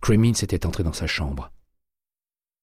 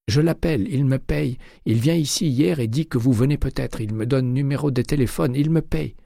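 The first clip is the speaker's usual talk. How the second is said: high, choked pleading voice; frightened clerk; 9 semitones higher, 3 dB louder than usual. A narrator's reading. Terrified clerk under McCaleb's arm, high and pleading. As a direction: beg to be let go.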